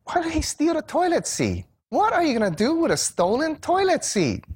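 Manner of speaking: high, exasperated question; puzzled visitor asks the driver, high and incredulous; baffled disbelief. Retold or imitated. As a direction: pitch it way up in total bafflement. Baffled by missing toilet seats.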